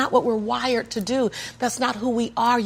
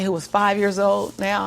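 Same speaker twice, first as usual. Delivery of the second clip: ordinary talk, nothing marked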